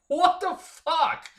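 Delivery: high, slow, drawn-out disbelief; stunned what the fuck. Retold or imitated. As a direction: stretch it out in shocked disbelief. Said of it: "I'm like".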